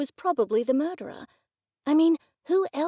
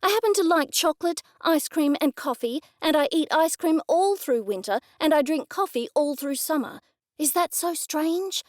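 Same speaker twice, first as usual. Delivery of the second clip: irritated; 6 semitones higher than usual. A narrator's reading. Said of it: "I snapped".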